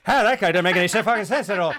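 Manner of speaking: loud, garbled mock Irish Traveller accent; Brad Pitt in Snatch. Imitating Snatch's fighter, garbled Irish Traveller accent. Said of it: "Brad Pitt from, uh, from Snatch sits down and goes".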